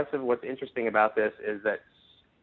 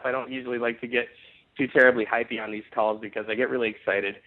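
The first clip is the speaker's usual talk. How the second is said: ordinary delivery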